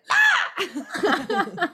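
high laughing yelp; mock startled yelp. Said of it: "whenever I'd be like".

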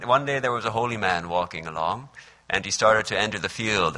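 usual speaking voice

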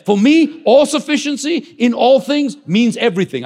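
loud, high, rising sermon cry; thundering pulpit proclamation; full-force preaching. Acted. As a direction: preach it out, building with conviction. Preaching peak.

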